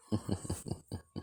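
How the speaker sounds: soft, breath-only villain chuckle; quiet evil snicker; sinister chuckle. Acted. Villain chuckles at hidden camera.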